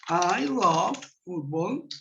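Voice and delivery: slow, deliberate English example; slow model sentence. Shown as a demonstration. Teacher modelling a sentence slowly for students. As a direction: say it slowly for learners. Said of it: "Lo mismo podemos utilizar con el love".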